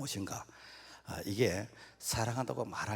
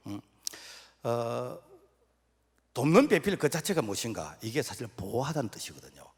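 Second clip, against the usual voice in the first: usual speaking voice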